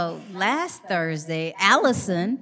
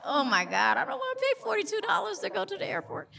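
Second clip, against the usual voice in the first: much higher, fast, in a put-on voice; mock outrage; 13 semitones higher, faster than usual. Traveller groaning to himself about the airport fare, high and fast. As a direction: rattle it off, scandalised at the price. Retold inner thought about cost.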